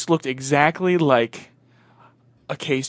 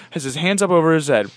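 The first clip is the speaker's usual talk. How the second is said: ordinary delivery, nothing marked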